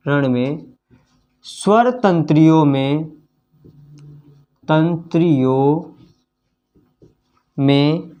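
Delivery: slow, drawn-out dictation pace with long pauses. Shown as a demonstration. Teacher to pupils writing the definition, slow dictation. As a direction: spell out each phrase for the class to write. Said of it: "तो यहाँ पे लिख सकते हैं".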